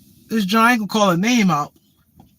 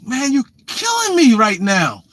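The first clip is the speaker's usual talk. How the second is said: drawn-out, higher-pitched mock complaint; exasperated; 5 semitones higher, 3 dB louder than usual. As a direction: act out being worn down, stretching the words. Acting out his exasperation with her.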